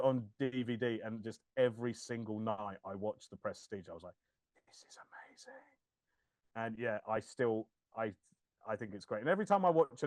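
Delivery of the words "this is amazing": unvoiced hushed whisper of wonder; awed whisper. Recalling watching The Prestige as a boy, in an awed whisper. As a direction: breathe it out in awe. Awed thirteen-year-old self.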